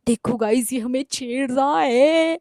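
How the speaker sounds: slow, put-on vlogger voice; mock sister filming for her followers. Imitating her sister vlogging instead of calling police, put-on vlogger voice. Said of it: "वो तो vlog बना रही है".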